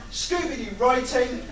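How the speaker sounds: usual speaking voice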